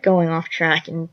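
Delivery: usual speaking voice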